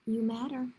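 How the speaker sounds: ordinary delivery, nothing marked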